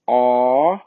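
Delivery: O as a long open "aw", rising. Shown as a demonstration. Teacher showing Portuguese vowels, open O held long. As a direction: hold O wide open and glide up. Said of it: "o O aqui é aberto".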